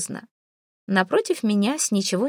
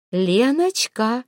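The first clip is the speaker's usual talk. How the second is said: drawn-out greeting call; 4 semitones higher, 3 dB louder, much slower than usual. Acted. Queen calls out on entering.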